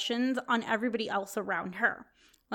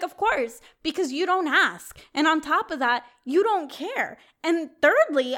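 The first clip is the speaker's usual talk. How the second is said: higher, louder indignant retort; retold straight-up telling-off; 5 semitones higher, 5 dB louder than usual. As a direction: lay out each grievance with mild indignation. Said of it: "I told her straight up".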